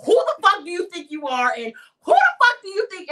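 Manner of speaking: loud, high, raging outburst at the ex; furious cursing. Retold or imitated. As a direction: spit out the rage, pitch climbing.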